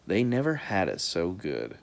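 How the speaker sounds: ordinary delivery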